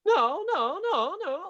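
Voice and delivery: very high, choppy repeated no; squeaky staccato protest. Retold or imitated. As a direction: bark out short high nos. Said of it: "like, very staccato".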